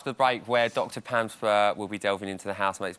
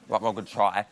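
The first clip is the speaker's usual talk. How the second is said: normal talking voice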